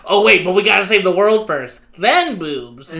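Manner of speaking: hammed-up nerd enthusiasm; Mineta. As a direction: call it out like an eager nerd. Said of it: "kind of, like, just a nerd".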